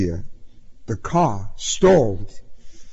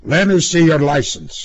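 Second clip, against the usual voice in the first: louder, higher demand from a cop; gruff order; 6 semitones higher, 5 dB louder than usual. A narrator's reading. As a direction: official command, pushed out firmly. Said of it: "he ordered".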